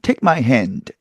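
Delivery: "Take my hand" with a stopped k; English-style "Take my hand", k kept before "my"; original pronunciation. Shown as a demonstration. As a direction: hold the k of "Take" without nasalising. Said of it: "이건데".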